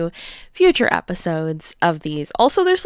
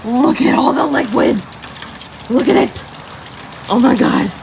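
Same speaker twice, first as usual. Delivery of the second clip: louder, thrilled at the rain; excited; 3 semitones higher, 5 dB louder than usual. Real, not acted. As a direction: point at the rain like a miracle.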